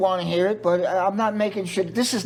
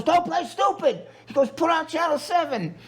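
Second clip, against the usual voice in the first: pitched-up mock yell of an order; retold yelling; 8 semitones higher than usual. Brother ordering him to put on Channel seven, mock yelling. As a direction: bark it like an angry big brother, half-acted. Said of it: "And he screamed at me".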